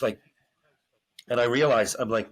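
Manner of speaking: ordinary talk, nothing marked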